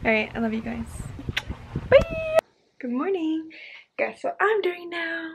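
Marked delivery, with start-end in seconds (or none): 1.9-2.4 squeaky high bye; high sing-song bye. Real, not acted. Vlogger signing off to viewers, very high sing-song. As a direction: toss off a bright, very high farewell.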